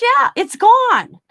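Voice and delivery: high, slow, drawn-out mock dismay; husband insisting the washer is gone, hammed-up disbelief. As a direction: stretch it out like a shocked husband.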